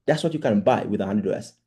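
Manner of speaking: ordinary talk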